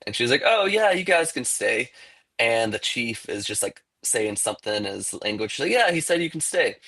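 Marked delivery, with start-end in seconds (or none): 0.4-1.9 lighthearted higher voice for the daughter, welcoming; imitating the chief's daughter. Daughter welcoming the settlers to stay, airy higher voice. 5.7-6.8 lighthearted higher voice for the daughter, welcoming; imitating the chief's daughter. Daughter welcoming the settlers to stay, airy higher voice.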